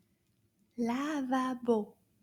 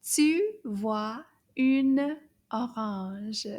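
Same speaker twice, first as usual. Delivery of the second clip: tu vois une orange slow and linked; slow and smooth; 4 dB louder, much slower than usual. Shown as a demonstration. Teacher's second, smoother read, slow. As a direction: glide through tu vois une orange slowly. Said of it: "Encore, we'll say it again".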